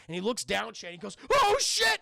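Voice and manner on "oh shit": high, loud panicked yell; hammed-up cry of sudden fright; mock panic. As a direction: yell it as if looking down from a height. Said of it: "he's, like, panicking".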